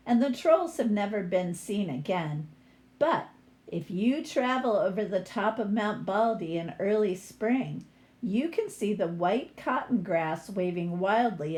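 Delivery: lively picture-book read-aloud; story reading. A narrator's reading. Teacher reading a troll story to her class, lively read-aloud.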